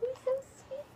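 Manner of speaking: soft, high, tender coo. Real, not acted. Telling the child he's sweet.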